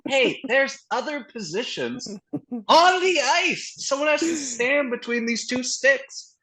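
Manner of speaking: very high, drawn-out mock pleading; exasperated. Fed-up fan telling the team about other positions, exasperated. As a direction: spell it out slowly in a high, exasperated voice.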